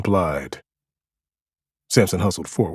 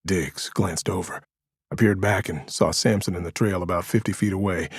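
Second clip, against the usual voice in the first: ordinary delivery, nothing marked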